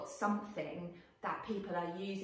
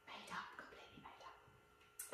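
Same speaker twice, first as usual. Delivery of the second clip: whispered aside; 18 dB quieter than usual. Real, not acted.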